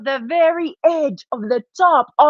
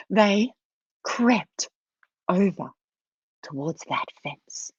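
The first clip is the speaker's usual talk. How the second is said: low, halting, fading almost away; creeping hush; 7 semitones lower, 7 dB quieter than usual. A narrator's reading. Storyteller follows the mice creeping towards the fence, hushed. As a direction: creep through it word by word, ever softer.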